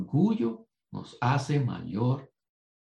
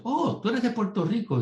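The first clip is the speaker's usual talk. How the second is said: surprised remark; 12 semitones higher than usual. Retold or imitated. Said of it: "los hispanos dicen".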